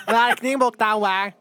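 high, slow made-up alien talk. Pretend. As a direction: babble it like a space alien. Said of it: "I was speaking alien".

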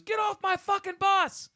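very high, loud, slow screaming order; boot camp yell. Retold or imitated. Host recalling the boot camp instructor's first order, screaming. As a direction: scream it like a drill instructor on the bus. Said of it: "the first thing was".

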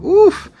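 high, loud "oof" as if hit; impressed oof. Retold or imitated. Host reacting to a heart-stopping lyric, impressed high oof. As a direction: let out a sharp, high oof.

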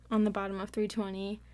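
ordinary delivery

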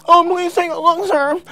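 high, loud, hammed-up plea; mock begging. Artist mocking his own emails to an editor, pleading. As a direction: play up an eager supplicant's begging.

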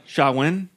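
"Samhain" said as SHAH-win; pronounced as Gaelic. Shown as a demonstration. Explaining the festival's Celtic name, pronounced SHAH-win. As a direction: say "Samhain" with a w and no m sound. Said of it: "That's how they say to pronounce it".

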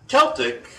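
CELTIC pitched high, with a hard K. Shown as a demonstration. Host settling on the proper word, high hard-K Celtic. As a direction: punch out "KEL-tik", high. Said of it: "put a K in there".

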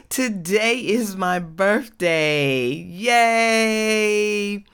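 high, drawn-out celebratory whoop with a laugh; real birthday glee, high and stretched; excited birthday cheer. Real, not acted. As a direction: greet listeners gleefully, stretching out the words. Celebrating her birthday on air.

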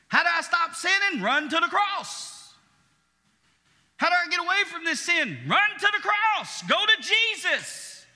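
high, loud preaching cry building up; full-force preaching. Acted. Pastor crying out to his congregation, high and loud. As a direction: preach it out, calling to the crowd.

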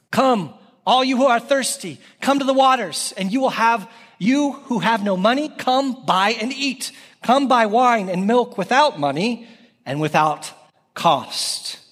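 high, measured call of a Bible verse; scripture reading. Acted. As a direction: proclaim the verse with lifted pitch. Reads scripture aloud in sermon.

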